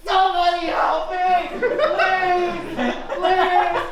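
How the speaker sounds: delivery robot begging to be rescued; desperate robot. Pretend. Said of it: "it's probably gonna be like this".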